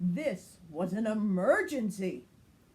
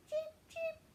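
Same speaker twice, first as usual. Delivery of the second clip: very high tiny chirping cheep; gosling chirp; 4 dB quieter than usual. Acted. Fuzzy begging his new friend to stay, very high chirps. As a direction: peep it like a baby goose.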